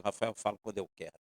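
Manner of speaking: God replying low and quiet; God's voice. Retold or imitated. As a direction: answer Rafael quickly and low. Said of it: "falou em voz audível assim comigo".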